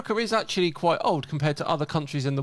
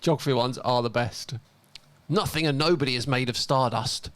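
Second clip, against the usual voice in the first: ordinary talk, nothing marked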